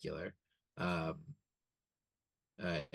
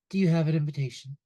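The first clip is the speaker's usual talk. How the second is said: woman at the door; 7 semitones higher, 9 dB louder, faster than usual. Acted. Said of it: "a woman goes".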